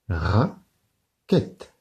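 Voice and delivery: Raquette, slow, ra and quette apart; split into syllables. Shown as a demonstration. Teacher to pupils, Raquette broken into syllables. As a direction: beat out Raquette in two. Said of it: "Deux syllabes pour raquette".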